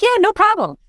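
high, breezy fake confidence; mock confident. Host retells faking confidence to husband, high breezy mock. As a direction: toss it off with faked bravado. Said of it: "I'm like".

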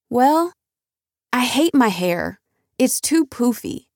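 put-on high little-girl voice, complaining; unhappy young daughter. A narrator's reading. Daughter telling Mama she hates her hair, high and childlike. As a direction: pipe it up like a small girl sulking about her hair.

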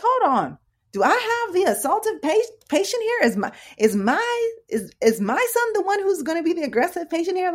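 very high, loud disbelieving questions; mock incredulity. Mom acts out her disbelief.

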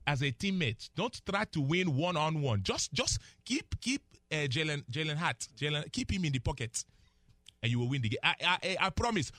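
put-on emphatic Nigerian accent, rising then dropping. Analyst warning the Cowboys against selfishness, in Nigerian accent. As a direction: lecture them in a Nigerian accent. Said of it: "the accent".

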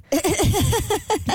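bright high-pitched laugh. Real, not acted. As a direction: laugh up high at your own joke. Laughing at own comeback.

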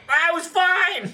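high, slow pained cry. Retold or imitated.

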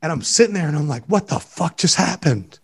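real tears breaking through the words; tearful disbelief. Real, not acted. Host to co-host, recalling the traffic-light moment, tearfully. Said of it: "I'm fucking crying right now".

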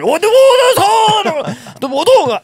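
high, loud, slow shout through laughter; hammed-up scalded yell, laughing; laughing mock panic. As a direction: yelp about the hot water while cracking up. Mock earthquake hot spring panic.

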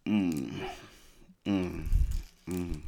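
chewing while humming; mouth full. Real, not acted. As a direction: mm through a mouthful. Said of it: "talk with my mouth full".

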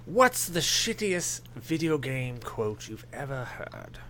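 very slow, put-on English accent; pompous British accent. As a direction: draw the question out like a pompous Brit. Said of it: "I'll do the pompous".

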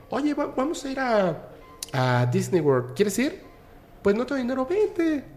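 high, light voice for the uncle; mock the uncle's casual offer; imitating uncle inviting.

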